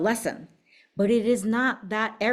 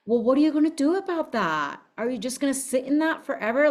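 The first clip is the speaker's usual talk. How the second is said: challenging; 6 semitones higher than usual. Retold or imitated. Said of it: "I'm like".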